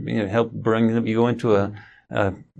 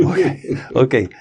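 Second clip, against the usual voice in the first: laughing imitation; 2 semitones higher than usual. Son calms down, laughing.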